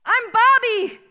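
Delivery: high, loud proud declaration; proud kid. Retold or imitated. Boy telling driver who he is, proud high voice. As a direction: say your name like a brave little boy.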